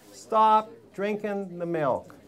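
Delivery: each word laid down slowly; slow it right down so it sinks in; a very slow, weighty order to stop the milk; slow, deliberate command. Shown as a demonstration.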